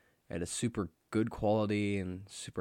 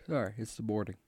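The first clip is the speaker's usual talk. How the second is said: ordinary talk, nothing marked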